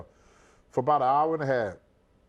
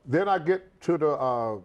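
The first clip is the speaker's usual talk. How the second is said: usual speaking voice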